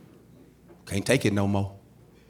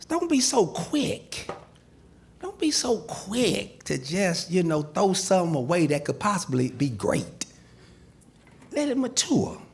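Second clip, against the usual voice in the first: gentle advice; 6 semitones higher, 3 dB quieter than usual. Shown as a demonstration. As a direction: coax the audience gently. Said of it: "Let me show you what I mean".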